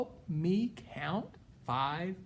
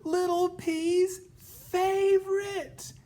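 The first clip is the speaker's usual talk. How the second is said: big reveal; 9 dB louder than usual. Acted. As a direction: announce it dramatically. Dramatic reveal.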